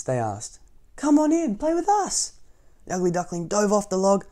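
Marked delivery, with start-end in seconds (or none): 1.0-2.3 very high, eager little voices; young swans. A narrator's reading. Young swans call the duckling into the water, high and eager. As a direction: invite him in, bright and high.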